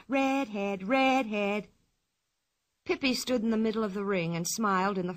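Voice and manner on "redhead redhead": taunting chant without real shouting; understated jeering scream; mild mock scream. A narrator's reading. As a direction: chant the taunt at her, voice contained. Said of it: "jumping up and down and screaming".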